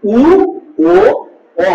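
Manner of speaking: উ ও অ each said alone, lips rounded; reciting the rounded vowels; rounded vowels demo. Shown as a demonstration. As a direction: sound out উ, ও, অ one at a time. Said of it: "তাহলে বলবে".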